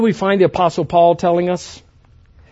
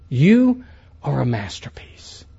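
breathy unvoiced whisper; 4 semitones lower, slower than usual. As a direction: whisper it like a secret blessing. Whispered declaration.

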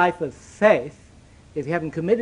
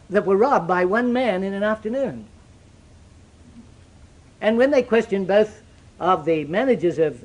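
normal talking voice

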